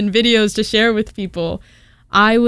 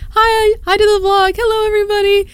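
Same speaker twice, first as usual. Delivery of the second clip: high, sing-out imitation of camera greeters; mock vlog greeting; 12 semitones higher than usual. Friends greeting the vlog camera, high mock cheer. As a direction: imitate people hamming it up for the vlog, high-pitched.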